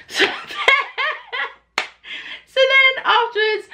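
high, giggling through it; laughing. Real, not acted. Telling friends, real laughter breaking through.

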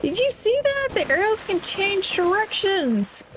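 high disbelieving shout; can't believe it; shocked. Real, not acted. Arrows change direction.

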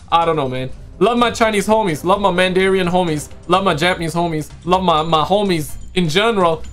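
ordinary talk

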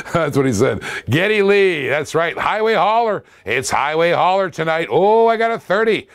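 usual speaking voice

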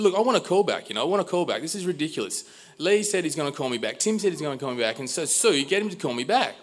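high, insistent retold demand; exasperated demand. Customer to Sue demanding a call back, exasperated. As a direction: plead your case high and insistently.

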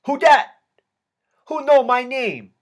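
put-on high, loud shout in a Vietnamese accent; heavy Vietnamese accent. A narrator's reading. Gangster asking who is calling him, heavy Vietnamese accent, shouted. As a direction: call back in a thick Vietnamese accent. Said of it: "asked in a heavy Vietnamese accent".

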